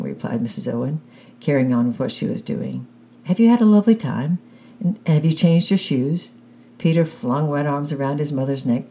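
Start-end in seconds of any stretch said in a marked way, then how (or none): 3.2-6.3 fussing mother. A narrator's reading. Mother fussing over wet son.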